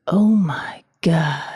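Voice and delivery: slow breathless whisper; husband's awed whisper at the window. Retold or imitated. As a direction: breathe it out, stunned at the view. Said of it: "is always just like".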